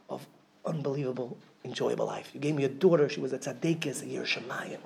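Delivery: soft, hushed, heartfelt thanks. Retold or imitated. Grieving father speaking softly of his daughter, hushed. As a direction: drop to a near hush of gratitude. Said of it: "he said".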